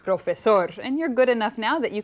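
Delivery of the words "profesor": "Profesor" said as pro-fe-SOR; stress on last syllable. Shown as a demonstration. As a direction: put the weight on the final SOR of "Profesor". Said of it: "with stress at the end".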